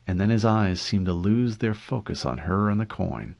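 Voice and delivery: plain reading voice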